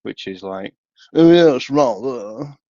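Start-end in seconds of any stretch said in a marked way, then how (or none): 1.1-2.6 slow, higher mock customer voice. Mimicking a customer, slow and higher. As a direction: drawl it out mockingly.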